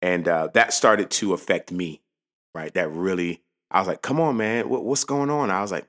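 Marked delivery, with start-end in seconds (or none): 4.0-5.5 frustrated coaching. Retold or imitated. Frustrated dad at son's game.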